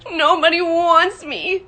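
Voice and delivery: put-on high, drawn-out little-boy wail; loud, plaintive whine on the verge of tears; nearly crying. A narrator's reading. Little Michael rejected by his brother, nearly crying. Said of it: "Michael had nearly cried".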